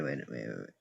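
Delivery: suddenly dropping to a near-silent wait, wait, wait; hushed alarm. Real, not acted. Player spotting a mistake in the puzzle, hushed alarm. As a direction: catch the mistake under your breath.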